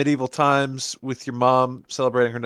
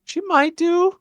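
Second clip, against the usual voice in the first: coy tease; slower than usual. Pretend. Teasing about captain's war crimes.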